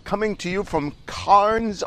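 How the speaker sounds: playful fake Australian accent; mock Australian accent. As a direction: greet them like a cheery Aussie, overdone. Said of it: "I'll kick the accent".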